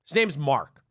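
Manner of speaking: ordinary talk, nothing marked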